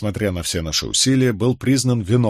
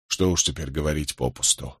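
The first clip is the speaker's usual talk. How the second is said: lower, softer, resigned annoyance; hint of vexation; 6 semitones lower, 6 dB quieter than usual. A narrator's reading. Husband shrugs off talk of the lost case, mildly vexed. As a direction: dismiss it quietly, a touch vexed. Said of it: "в голосе его я уловил нотки досады".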